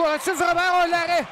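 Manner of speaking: high excited call; excited save call. Real, not acted. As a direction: lift up for the great save.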